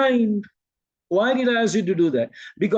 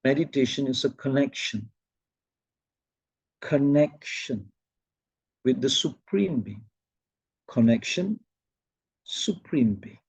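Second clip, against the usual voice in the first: imitating Shivani Ben; 4 semitones lower, 5 dB quieter than usual. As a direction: speak like a calm spiritual teacher, pausing. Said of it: "She'll say".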